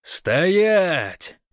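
high, loud drawn-out shout; bellowed command. A narrator's reading. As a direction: bellow a halt across the whole area. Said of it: "проорал на всю округу".

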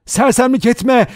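loud, high-pitched rebuke; crying out. A narrator's reading. Vera clings to Philip's arm, crying out. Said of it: "diye haykırdı".

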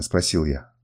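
plain reading voice

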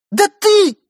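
high loud accusing shout. A narrator's reading. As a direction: fling it at him. Wife shouts at husband.

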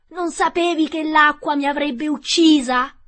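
high, loud desperate whine of a witch; whining, desperate. Acted. Melting witch whining to Dorothy, desperate. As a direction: wail it like a dying witch. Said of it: "con voce lagnosa e disperata".

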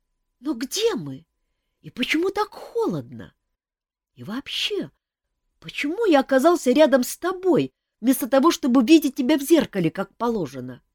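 high, small bewildered bird voice; a lost chick asking questions; puzzled chick voice. A narrator's reading. Chick Mafei asks Kantor where they are, high bewildered.

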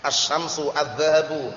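"Asy-syamsu, adz-dzahabu" with doubled sy and dz, no l; merged articles in "Asy-syamsu, adz-dzahabu"; silent lam. Shown as a demonstration. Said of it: "bacanya langsung ditabrakkan".

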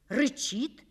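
plain reading voice

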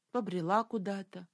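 plain reading voice

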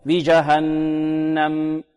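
"بجهنم" drawn out, long hummed n and sustained final m; measured final meem. Shown as a demonstration. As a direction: linger on both nasals of "بجهنم". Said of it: "نعطيها قدرها من التوسط". (إعطاء ميم "بجهنم" قدرها من التوسط)

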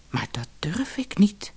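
gefluisterd, bang; bang gefluister. Acted. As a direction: fluister het angstig. Bange visser fluistert. (angstig gefluisterd)

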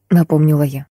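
ordinary delivery, nothing marked

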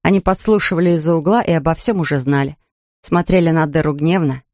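ordinary delivery

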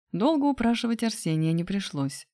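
plain reading voice

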